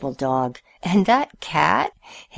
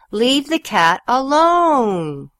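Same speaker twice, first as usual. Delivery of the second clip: slow, louder, higher telling-off; yelled; 8 semitones higher, 5 dB louder, much slower than usual. A narrator's reading. Owners yelling at Stanley to leave the cat, slow loud. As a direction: stretch it out, fed up. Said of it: "they yelled".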